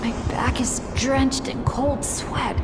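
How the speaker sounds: breathless, frightened whisper; panicked whisper. Acted. Bennett alone and panicking whispers to himself. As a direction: whisper through rising panic. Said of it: "My heart's beating faster".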